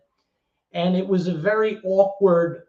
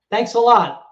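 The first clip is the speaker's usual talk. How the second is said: higher, louder mock thanks; sarcastic thanks; 6 semitones higher, 8 dB louder than usual. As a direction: thank him through clenched irony. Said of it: "So I was like".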